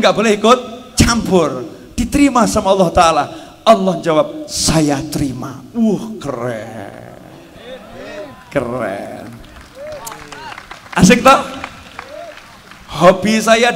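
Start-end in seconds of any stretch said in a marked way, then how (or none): 4.5-5.6 solemn divine answer. Acted. God answers. 5.7-9.3 slow, low amazement. Acted. Amazed reaction.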